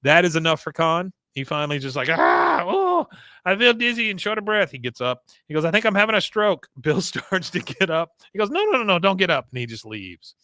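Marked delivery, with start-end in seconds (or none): 2.1-6.7 hammed-up mock fainting spell; faked stroke. Con fakes a stroke. 8.5-9.5 higher hurried no no no; higher flustered refusal; flustered Con. Retold or imitated. Con hurrying away.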